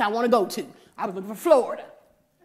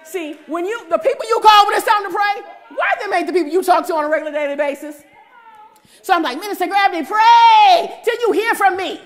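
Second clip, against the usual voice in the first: preaching at full force; 10 semitones higher, 13 dB louder than usual. Acted. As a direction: preach it, then call out the prayer request. Preaching climax.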